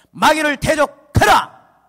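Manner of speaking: high, slow, booming shout; full-force scriptural exhortation; shouted command. Acted. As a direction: proclaim it as a battle order.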